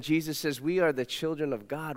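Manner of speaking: usual speaking voice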